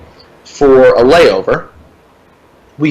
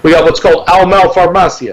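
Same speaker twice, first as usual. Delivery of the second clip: put-on light Puerto Rican accent, a bit higher; 4 semitones higher than usual. Kid on a bike giving directions, light Puerto Rican accent. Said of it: "Because it's a Puerto Rican accent".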